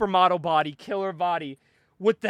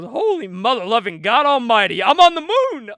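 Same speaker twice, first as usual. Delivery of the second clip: mock astonished cry; 3 semitones higher, 6 dB louder than usual. Hitman arriving in space, imitated astonished shout.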